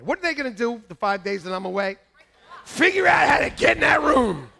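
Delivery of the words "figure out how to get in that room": high, loud comic punchline; emphatic shout. Real, not acted. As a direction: shout out the obvious answer. Shouted answer to own question.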